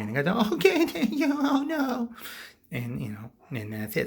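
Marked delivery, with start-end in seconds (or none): 0.5-2.1 very high, quavery thanks; hammed-up panicky falsetto; mock frightened relief. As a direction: squeak it out like a terrified survivor. Frightened guy allowed to leave.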